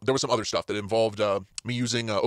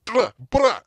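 loud higher "bruh" shout; mock yell; 10 semitones higher, 6 dB louder than usual. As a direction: bark it at the thieves. Imagined confronting thieves.

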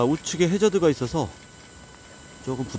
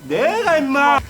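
high, loud taunting yell; trash talk shout; 13 semitones higher, 8 dB louder, much slower than usual. Real, not acted. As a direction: shout the boast at a friend. Trash talk between golf friends.

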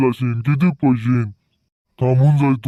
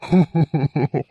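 far-off spooky laugh; ghostly laugh; 4 semitones higher than usual. Acted. (भुताचं हसणं)

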